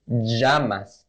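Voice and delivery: llamas, LL like a zh; J sound. Shown as a demonstration. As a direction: say llamas with the LL as the J in "measure". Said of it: "the J sound".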